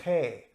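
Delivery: G named with a guttural scraped onset; G as a back-of-mouth fricative, not a hard g; Dutch letter G. Shown as a demonstration. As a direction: say the letter G as throaty "khay". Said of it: "G letter in Dutch is called".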